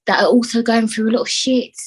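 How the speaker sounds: normal talking voice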